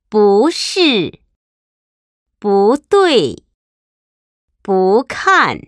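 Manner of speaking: rising 不 before a falling 是, 对, 看; 不 in second tone. Shown as a demonstration. Teacher models 不是, 不对, 不看, 不 in rising second tone. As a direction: lift 不 upward, then drop on 是, 对 or 看. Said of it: "pronounced with the second tone".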